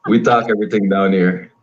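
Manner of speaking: usual speaking voice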